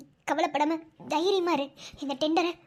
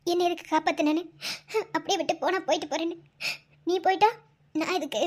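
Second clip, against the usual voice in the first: ordinary delivery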